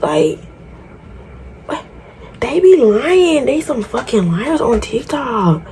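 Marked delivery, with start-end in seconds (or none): 2.4-5.7 exasperated. Real, not acted. Frustrated at TikTok advice.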